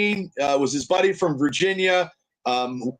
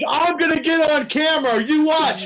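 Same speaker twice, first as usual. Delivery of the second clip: higher, hammed-up rowdy fan boasting; imitating Larry; 8 semitones higher than usual. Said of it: "He goes, he goes".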